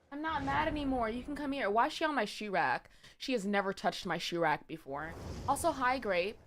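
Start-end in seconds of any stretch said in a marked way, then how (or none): none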